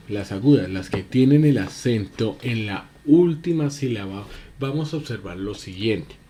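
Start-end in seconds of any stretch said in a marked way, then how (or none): none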